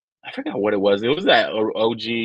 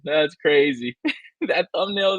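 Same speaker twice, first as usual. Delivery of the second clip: ordinary delivery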